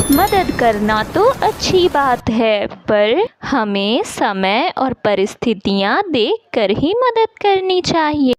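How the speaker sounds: plain reading voice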